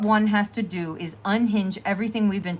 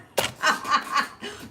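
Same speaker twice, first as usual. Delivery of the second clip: breathy laugh; 8 semitones higher than usual. Real, not acted. Laughing after reading.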